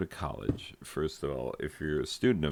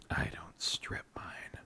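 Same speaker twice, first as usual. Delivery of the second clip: soft, voiceless whisper; hushed comic aside; 8 dB quieter than usual. Acted. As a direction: say it under the breath. Repeats joke under breath.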